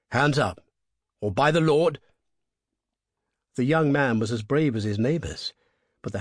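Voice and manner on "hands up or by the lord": a firm order turning louder into a threat; stern threat. A narrator's reading. Masked robber repeating his order, stern and threatening. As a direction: press the command with menace. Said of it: "said a quick, stern voice".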